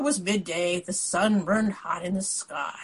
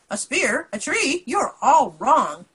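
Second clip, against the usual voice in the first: raised, higher incredulous shouting; 5 semitones higher, 6 dB louder than usual. A narrator's reading. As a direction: shout the questions in disbelief. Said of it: "The fifth man started to shout".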